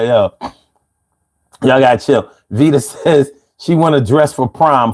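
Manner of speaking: ordinary talk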